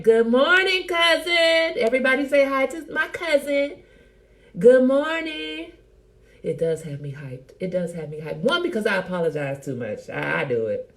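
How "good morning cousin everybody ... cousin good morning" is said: loud, high, drawn-out hello; excited greeting. Real, not acted. Host greets cousin before everybody, excited. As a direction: call out joyfully to the whole room.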